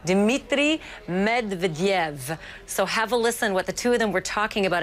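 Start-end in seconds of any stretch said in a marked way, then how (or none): 0.0-2.4 slow, drawn-out Russian accent. Shown as a demonstration. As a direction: say the name slowly in a playful best Russian accent. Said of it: "I'm gonna do my best Russian accent here".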